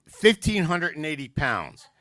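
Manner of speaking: loud blurted guess; drunk shout. Acted. Barfly blurting his guess at the lady, loud. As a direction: bellow it like a drunk in a bar.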